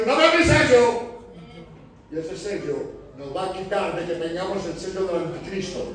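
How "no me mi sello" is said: high, loud claiming shout; possessive shout. Retold or imitated. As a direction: shout it as your own. God claims the seal.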